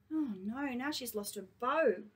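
usual speaking voice

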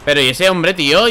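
normal talking voice

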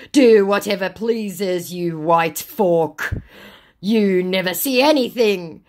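hammed-up angry send-off; loud scammer retort. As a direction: drag it out loudly as the scammer. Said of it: "your eardrums going".

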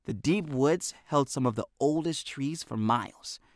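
ordinary delivery, nothing marked

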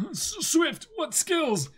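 high, loud hammed-up warrior voice; loud and high, playing it big; character voice. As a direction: belt it out like an anime hero. Reading manga characters aloud.

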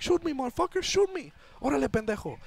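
very high-pitched mock daring shout; defiant taunt. Bus driver taunting the man with the gun, acted defiance. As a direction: imitate a reckless driver egging someone on, pitch way up.

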